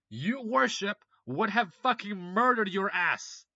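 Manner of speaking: loud, slow and disgusted; slow angry rant. Real, not acted. Berating Russians who admire neo-Nazis: loud, slow disgust. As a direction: drag it out in contempt.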